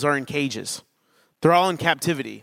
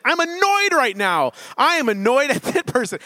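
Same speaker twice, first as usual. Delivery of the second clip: high-pitched comic irritation with a laugh in it; playful outburst, pitched well up; mock annoyed; 9 semitones higher than usual. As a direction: act out discovering how annoyed you are. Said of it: "I'm like, "Okay, I'm feeling angry".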